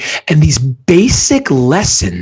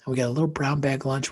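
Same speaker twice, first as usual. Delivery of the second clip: ordinary talk, nothing marked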